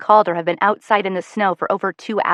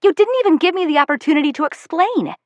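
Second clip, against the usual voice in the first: angry yell; 9 semitones higher than usual. A narrator's reading. Daughter yelling at her mother, furious. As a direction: let the grievance burst out. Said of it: "I yell".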